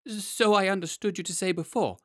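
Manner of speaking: high, trembling fright; teeth chattering. Acted. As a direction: quaver it out as your teeth chatter. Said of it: "his teeth chattering".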